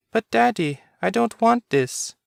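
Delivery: put-on high, pleading child's voice; small son begging his father; little boy. A narrator's reading. Child character speaks.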